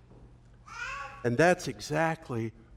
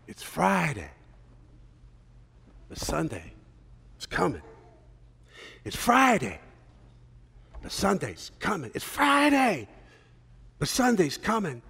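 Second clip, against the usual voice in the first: slow preaching refrain. Retold or imitated. The old pastor opening his sermon, slow preaching. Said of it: "He started out slow".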